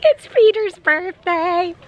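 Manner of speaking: high, slow announcing; excited announcement. Real, not acted. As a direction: cheerfully announce the birthday. Announcing birthday to camera.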